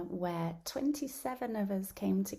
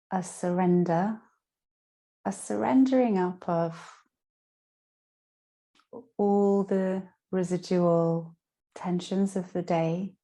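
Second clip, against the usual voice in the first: guided meditation; 10 dB louder than usual. Acted. Yoga teacher guiding listeners lying down through Yoga Nidra, guided meditation. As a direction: guide them down into rest, phrase by phrase.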